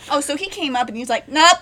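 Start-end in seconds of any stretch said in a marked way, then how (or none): 1.3-1.6 short, much louder and higher nope; loud panicked refusal. Retold or imitated. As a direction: blurt it out like he's fleeing the monster. Diver surfacing in panic.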